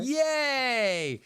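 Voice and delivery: high delighted shout; excited cheer. Real, not acted. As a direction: cheer with relief at reconnecting. Reconnected, host cheers.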